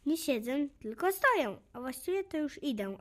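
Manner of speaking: plain reading voice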